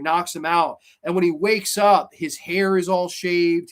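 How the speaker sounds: usual speaking voice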